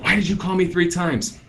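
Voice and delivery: high-pitched mock complaint, no real volume; hammed-up indignant caller; mock angry yell. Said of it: "does start yelling at you".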